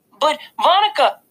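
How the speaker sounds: put-on a toddler tugging for attention; small child calling. A narrator's reading. Little brother calls to the babysitter, high child voice.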